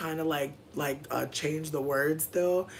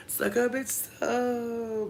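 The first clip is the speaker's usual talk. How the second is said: drawn-out joke; 7 semitones higher, much slower than usual. Acted.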